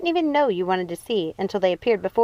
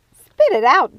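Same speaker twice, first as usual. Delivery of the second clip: loud impatient yell at the mirror; sharp shouted prod; 7 semitones higher, 9 dB louder, slower than usual. A narrator's reading. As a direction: shout it at her to hurry up. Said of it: "yelled Ruby at the mirror".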